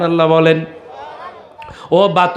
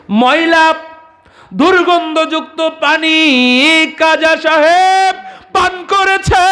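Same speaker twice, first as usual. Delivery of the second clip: preaching at full force; 11 semitones higher than usual. Acted. Preacher telling a saint's story to the crowd, at full force. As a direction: call it out to the crowd like a village waz. Preacher stresses the dirty water.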